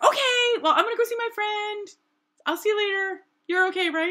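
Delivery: loud, high-pitched breezy friend imitation; Lonnie. Friend leaving to see someone, high mock voice. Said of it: "She's like".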